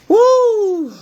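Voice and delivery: high laughing whoop; loud joyful whoo; excited whoop. Real, not acted. Celebrating after the squats.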